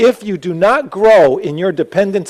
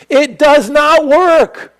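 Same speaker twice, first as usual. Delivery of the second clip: much higher, louder, slow and firm; emphatic; 11 semitones higher, 6 dB louder, slower than usual. Real, not acted. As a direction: hammer home every word. Preacher emphatic rebuttal.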